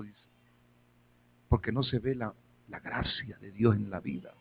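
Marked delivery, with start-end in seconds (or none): none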